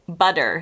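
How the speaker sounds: "butter" with the T softened to a D; American flapped T in "butter"; T flapping. Shown as a demonstration. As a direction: say "butter" like "budder". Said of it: "sounding like it's with a D".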